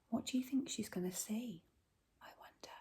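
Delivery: gasp, then a quick whisper; suspense whisper. Acted. Teacher whispering to build suspense for children. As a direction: hush them with a secret.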